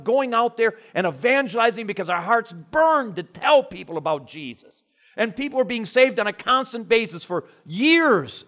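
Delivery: loud, high, fired-up sermon delivery; full-force preaching. Acted. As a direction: preach it out with burning conviction.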